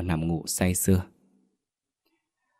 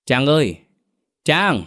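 father calling his daughter, higher-pitched; raised-pitch call of her name; 6 semitones higher than usual. A narrator's reading. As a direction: call toward a closed room. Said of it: "cất tiếng gọi con gái".

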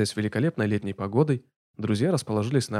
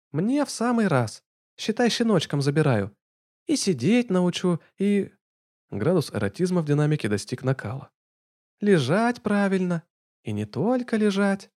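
slow, high breathy purr; 8 semitones higher than usual. A narrator's reading. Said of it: "сладко с придыханием прошелестел".

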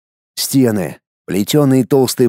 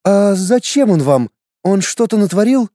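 worried mother; 6 semitones higher than usual. Acted. Mother questions the warrior.

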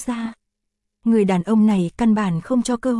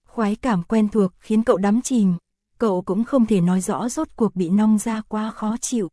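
plain reading voice

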